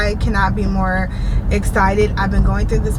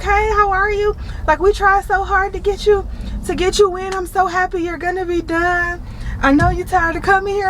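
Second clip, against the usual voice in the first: high, sweet receptionist voice; chirpy receptionist; 9 semitones higher than usual. Retold or imitated. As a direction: gush kindly at a regular patient. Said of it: "They're like".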